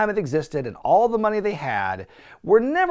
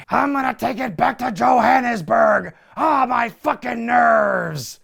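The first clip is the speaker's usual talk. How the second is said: raised mock South African heel shout; De Beers voice; 6 semitones higher than usual. Recapping De Beers grabbing the flag, hammed-up shout. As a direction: ham up the villain's gloating. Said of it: "As De Beers steals the American flag".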